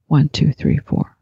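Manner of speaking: normal talking voice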